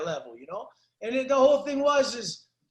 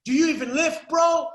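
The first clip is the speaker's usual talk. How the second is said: mocking gym taunt; 5 semitones higher, 5 dB louder than usual. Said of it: "the dude said".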